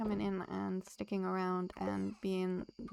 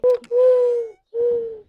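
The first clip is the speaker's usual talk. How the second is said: sharp delighted gasp, much higher and louder; excited gasp; 17 dB louder than usual. Real, not acted. Streamer sees a new follower join, delighted gasp. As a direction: gasp of real surprise at the alert.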